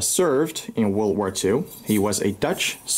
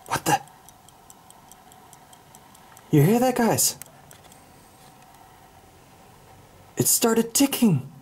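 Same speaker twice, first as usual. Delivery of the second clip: high, hushed and uneasy, hearing something tick; real creeped-out surprise, pitch jumping up; spooked; 12 semitones higher than usual. Real, not acted. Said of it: "I'm getting goosebumps".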